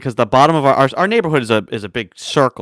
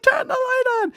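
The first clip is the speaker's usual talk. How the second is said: high, softer squeal; mock panicked plea; 5 dB quieter than usual. Kids pleading with dad, small high mock squeal. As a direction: imitate a scared kid squealing high.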